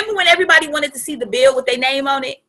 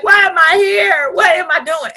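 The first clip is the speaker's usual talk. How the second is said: retold labor cry; 5 semitones higher, 5 dB louder than usual. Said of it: "I remember that".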